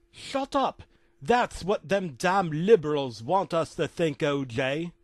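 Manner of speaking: loud put-on rant in a character voice; hammed-up angry outburst; irate rant. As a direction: cut them off high, then rail on loudly. Phil scolds O J in story.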